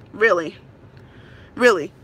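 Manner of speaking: "Really?" twice, low and flat with a falling end; deadpan "Really?" that drops at the end, not asking; flat disbelief. Real, not acted. Tenant to the leasing office, low flat disbelief.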